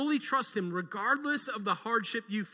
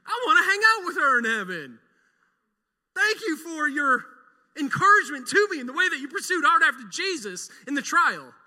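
higher, louder retold thought; mock excited self; 7 semitones higher, 10 dB louder than usual. Preacher replaying his own Easter change of heart, excited. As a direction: gush like a convert. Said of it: "I'm like".

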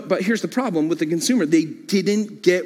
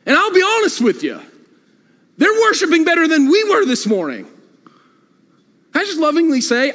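emphatic; 7 semitones higher, 4 dB louder, faster than usual. Real, not acted. Pastor scolds his congregation.

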